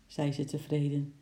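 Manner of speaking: ordinary delivery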